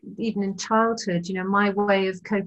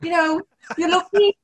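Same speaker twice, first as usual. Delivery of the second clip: mock-angry Liverpool scolding, much higher and louder; hammed-up Liverpool mum telling off naughty kids; Liverpool accent; 12 semitones higher, 6 dB louder than usual. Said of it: "screaming at them and saying".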